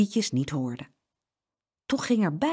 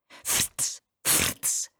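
whooshing frits sound; 5 dB quieter than usual. A narrator's reading. Parakeets fly out of suitcase.

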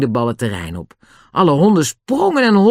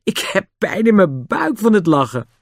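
laughing; 7 semitones higher than usual. Acted. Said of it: "veegde de tranen uit haar ogen".

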